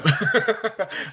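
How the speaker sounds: bright high burst of giggling; high giggle. Real, not acted. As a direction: giggle high after the joke. Laugh after hang-up bit.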